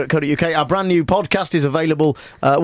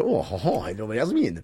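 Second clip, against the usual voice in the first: Welsh accent; 7 semitones lower, 7 dB quieter, slower than usual. Shown as a demonstration. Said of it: "terrible Welsh accent".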